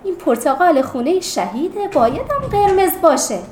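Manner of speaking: loud, urgent call to friends; loud exclamation. Acted. Girl correcting her classmates, exclaiming loudly. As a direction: raise your voice to set them straight. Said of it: "یکو شفیقه بلند گفت".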